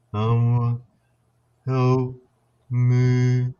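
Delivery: droning, stretched-out spirit voice; eerie monotone drone, very slow; deep slow voice. Retold or imitated. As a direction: imitate a slowed-down recording, each word held on one note. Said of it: "I hear this deep voice, well, slow".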